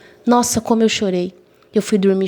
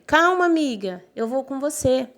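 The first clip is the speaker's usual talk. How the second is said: higher, sing-song imitation of the friend; pleading friend; 6 semitones higher, 3 dB quieter than usual. Friend chasing her to the classroom, in a high pleading imitation. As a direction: mimic her friend calling her to wait.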